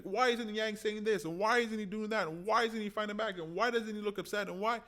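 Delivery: high, exasperated string of complaints; mock frustrated whining. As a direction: pile on the whiny why questions. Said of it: "And you're like".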